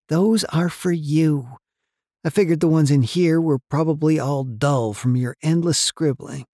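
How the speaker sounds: high, friendly woman's voice; kind woman. A narrator's reading. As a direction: offer it kindly. Barbie speaking kindly to Jake.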